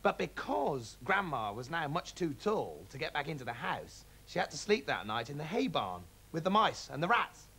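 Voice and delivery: ordinary delivery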